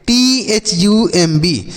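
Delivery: letter by letter, spelling टी एच यू एम बी; slow spelling. Shown as a demonstration. Teacher slowly spells टी एच यू एम बी for the children. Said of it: "इसे हम बच्चों पढ़ते हैं थम".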